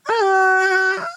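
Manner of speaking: put-on high crying wail of a small child; Franek wailing. Little Franek squashed by Basia, crying wail. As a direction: let out a toddler's howl. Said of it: "rozdarł się Franek".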